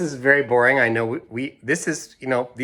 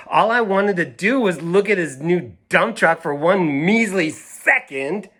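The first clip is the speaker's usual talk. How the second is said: put-on higher, animated read-aloud of a stubborn girl; reading to children; 6 semitones higher than usual. A narrator's reading. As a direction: play the indignant kid making her case. Reads girl's defiant list.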